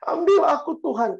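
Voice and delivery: high-pitched mock sobbing plea; imitated tearful wailing. Mother pleading beside her child's coffin, imitated tearful wail. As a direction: act out a wailing mother begging God. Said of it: "mamanya di samping peti itu ya nangis-nangis".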